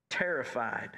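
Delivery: ordinary talk, nothing marked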